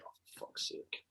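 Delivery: under-the-breath exasperated swear; muttered curse. Retold or imitated. To himself on hearing the news, a muttered curse. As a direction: mutter it in disgust.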